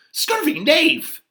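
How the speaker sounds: high, slow indignant squawk; hammed-up fury; vexed outburst. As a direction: spit the insult out in outrage. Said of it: "I am so vexed".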